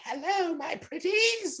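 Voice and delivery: high, drawn-out hammy witch greeting. Retold or imitated. Villainess tries to charm the two in the church, awful witchy voice. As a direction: lure them in like a cartoon witch. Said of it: "pure awful stereotypical witchy voice".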